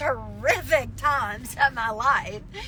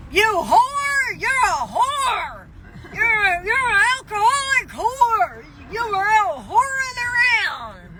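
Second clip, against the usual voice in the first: mom's rant; 5 semitones higher than usual. Retold or imitated. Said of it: "she started running her mouth".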